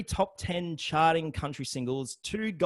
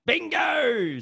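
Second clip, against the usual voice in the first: a high, loud burst of delight at the right answer; excited Bingo; 9 dB louder than usual. Real, not acted. Host cheering the guest for naming Miranda Kerr, excited high shout. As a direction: shout it like the quiz answer just landed. Guest names the model he forgot.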